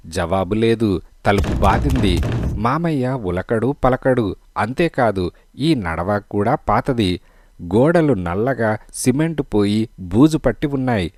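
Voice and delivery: usual speaking voice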